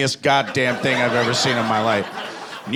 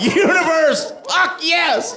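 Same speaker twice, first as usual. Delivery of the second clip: ecstatic cry; much slower than usual. Retold or imitated. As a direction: yell it like you just won everything. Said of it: "And I was like".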